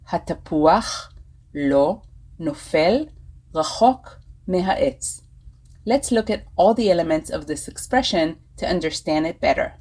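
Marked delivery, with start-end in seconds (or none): 0.1-5.2 slow repeat in short chunks; slower. Shown as a demonstration. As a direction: say it slowly for learners, pausing between chunks. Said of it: "I will say it slower".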